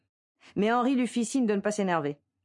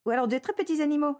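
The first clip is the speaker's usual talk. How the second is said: put-on higher, childish little voice; very childlike; 9 semitones higher, faster than usual. A narrator's reading. Anna adding a thought, childlike and higher. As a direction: say it like a small child would. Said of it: "ajouta-t-elle très enfantinement".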